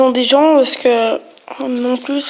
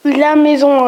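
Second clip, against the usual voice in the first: ordinary talk, nothing marked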